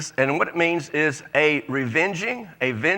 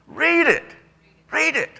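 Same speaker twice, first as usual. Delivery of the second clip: urging; 12 semitones higher than usual. Real, not acted. Urging congregation to read.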